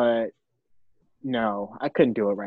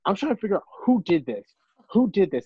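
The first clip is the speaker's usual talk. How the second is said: a touch of angry, fast and higher, demanding who did it; hammed-up indignant friend, fast and a bit higher; mock angry demand; 6 semitones higher, faster than usual.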